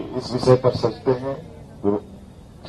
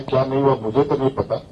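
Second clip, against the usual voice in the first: ordinary talk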